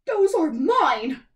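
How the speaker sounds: loud, slow high-pitched shriek. A narrator's reading. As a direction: screech it possessively. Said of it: "shrieked the stepmother".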